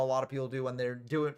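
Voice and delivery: ordinary talk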